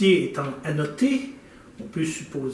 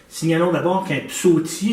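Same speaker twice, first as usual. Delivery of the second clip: normal talking voice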